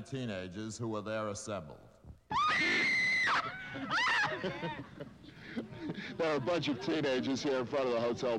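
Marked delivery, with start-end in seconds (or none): 2.1-6.1 very high shrieking of fans; crowd scream. Real, not acted. Teenagers assembled outside the hotel, high screaming. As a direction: fans screaming at the top of their voices.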